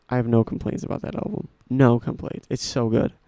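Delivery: ordinary talk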